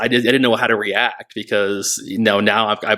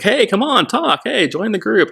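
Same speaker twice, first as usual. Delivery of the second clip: much higher, singsong coaxing; mock pushy staff; 12 semitones higher than usual. Staff crowding the silent new girl, mock high coaxing. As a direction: imitate staff nagging her to join in.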